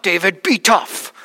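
put-on slow Russian-accented repeat of the encouragement; drawn-out Russian accent, steady and insistent. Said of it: "with that thick Russian accent".

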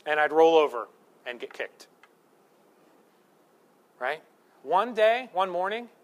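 ordinary delivery

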